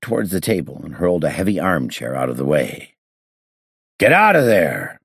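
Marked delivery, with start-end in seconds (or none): 4.0-5.0 loud, high-pitched bark of command; fierce order. A narrator's reading. Inspector ordering the hidden man out, fierce shout. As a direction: fiercely order someone out, voice raised. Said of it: "I ordered fiercely".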